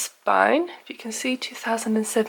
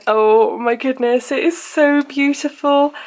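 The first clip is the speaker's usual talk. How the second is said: delighted; 4 semitones higher, 4 dB louder than usual. Real, not acted. Opening the planner.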